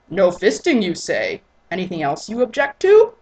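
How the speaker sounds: loud amused pressing. Acted. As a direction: push her for an answer.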